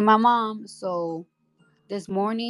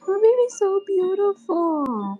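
very high, slow, sing-song cooing; much slower than usual. Real, not acted. Cooing at baby for many lines.

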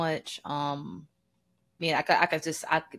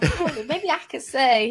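timid suggestion; 6 semitones higher, 3 dB louder than usual. Retold or imitated. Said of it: "I'm like".

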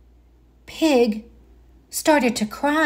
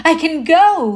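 happy reply; 8 semitones higher, 7 dB louder than usual. A narrator's reading. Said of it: "he said".

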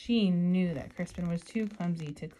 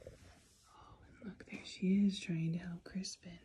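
whispering. Real, not acted. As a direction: whisper so the little ones stay calm. Aside to kids during reading.

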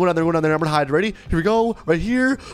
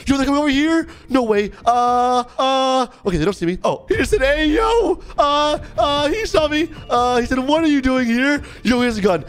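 panicked; 8 semitones higher, 3 dB louder than usual. Real, not acted. Caught hiding during gun deal.

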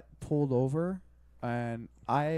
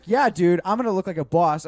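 louder, pumped-up bragging; cocky hype; 4 semitones higher, 12 dB louder than usual. Retold or imitated. Driver boasting to friends about outsmarting the cop, loud and cocky. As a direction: hype yourself up like a boss.

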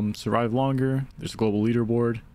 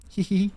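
high little goblin hee hee; a mischievous goblin titter; goblin giggle; 9 semitones higher than usual. Shown as a demonstration. Streamer trying goblin voice, high giggle. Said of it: "high with a little spit".

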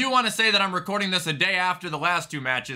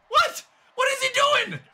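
very high-pitched disbelief; squeaky, stunned shout; incredulous squeal. Real, not acted. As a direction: yelp the question in total bafflement. Baffled by opponent's move.

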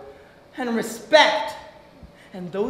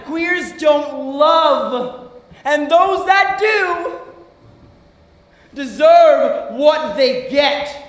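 slow, raised, shouted sarcasm; bitter outrage; 3 dB louder, much slower than usual. Acted. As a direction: stretch each word out in fury. Bitterly throws prejudice back.

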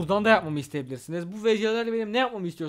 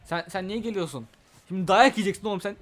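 ordinary talk, nothing marked

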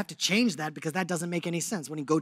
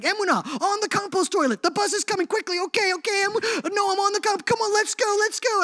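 put-on high, loud hurried calling to the kids; hurried yelling; 14 semitones higher, 7 dB louder than usual. Mother hurrying her kids past the compost toilet, frantic high calling. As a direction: rush the kids along in a frantic high shout. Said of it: "It's like".

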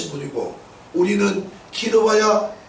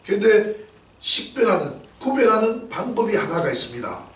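usual speaking voice

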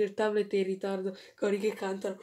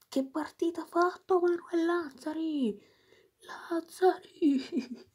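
ecstatic cry; 8 semitones higher than usual. Real, not acted. Fan raving about Lazzari's match, ecstatic cry. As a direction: cry the name out in delight.

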